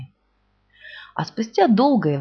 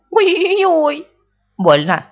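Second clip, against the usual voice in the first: much higher, louder "Ой-ой-ой"; cry of pain; 9 semitones higher, 6 dB louder, much slower than usual. A narrator's reading. Pooh yelps in pain at the pulling rabbit, loud. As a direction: yelp as you're pulled. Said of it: "Винни не закричал".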